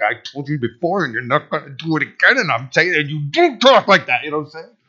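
tight-voiced parent telling off a child; mock stern talking-to; acted parental scolding. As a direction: scold your kid up close, holding back the volume.

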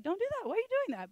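high-pitched fussy scolding; mock scold. Pretend Paul scolding his host, high and fussy. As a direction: wag a finger in a high voice.

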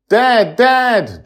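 high, loud calling of dad; a frightened girl calling for her father; desperate girl. A narrator's reading. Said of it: "with desperation in her eyes".

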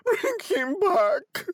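slow, very high wail; anguished cry. A narrator's reading. Old woman pleading for her son, anguished cry. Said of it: "cried the old woman".

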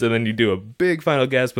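ordinary talk

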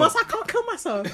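high laughing repeat of her line; cracking up while repeating it. Real, not acted.

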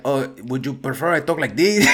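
a light, hammed-up Mexican accent; mock Mexican accent to unsettle the manager; Speedy Gonzalez voice. Teasing a surprised manager, light mock Mexican accent. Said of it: "This goes Speedy Gonzalez on us?".

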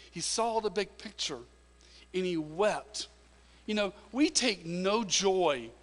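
slow, high, loud preaching build. Acted. Pastor preaches to the family about weeping with others, rising and loud. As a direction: drive it home from the pulpit.

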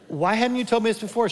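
high, fast, eager question; excited protest. Retold or imitated. Husband asking his wife why she never told him, high and fast. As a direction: press her for an answer.

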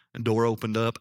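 normal talking voice

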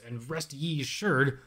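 ordinary talk

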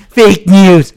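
mock Trump fake news. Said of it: "called them out saying".

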